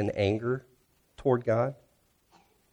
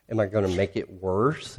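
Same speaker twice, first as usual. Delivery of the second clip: ordinary talk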